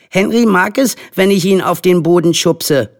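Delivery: put-on high, eager child's voice; insistent little boy. A narrator's reading. Charlie backing up Henry's claim, eager insistence. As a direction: chime in keenly like a small boy.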